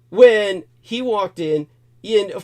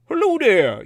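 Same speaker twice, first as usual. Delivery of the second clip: high-pitched goofy cartoon hello; a goofy, dorky greeting; dork cartoon voice; 9 semitones higher, 3 dB louder than usual. Repeating the blind man's words in a dorky cartoon voice. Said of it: "dork accent, like a dork cartoon character".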